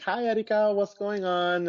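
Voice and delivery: lightly voiced mom checking in on the phone; mother on the phone.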